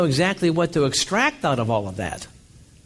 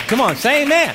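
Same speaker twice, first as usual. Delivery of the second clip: high, loud call to the congregation; rousing call; 8 semitones higher, 5 dB louder than usual. Real, not acted. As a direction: fire them up for an amen. Preacher urging congregation response.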